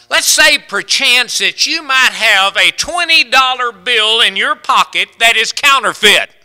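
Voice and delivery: loud, high, driving preacher's voice; full-throated revival preaching; preaching at full force. Acted. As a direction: hammer the point home to the congregation. Preaches the counterfeit bill example.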